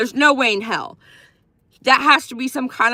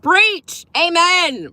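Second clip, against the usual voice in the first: mock preach cheer; 6 semitones higher, 4 dB louder, much slower than usual. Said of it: "I'm like".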